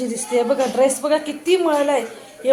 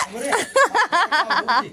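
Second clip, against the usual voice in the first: laughing; 4 semitones higher, 3 dB quieter than usual. Real, not acted. Laugh after the fall.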